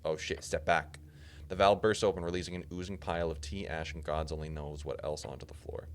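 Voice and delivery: ordinary talk, nothing marked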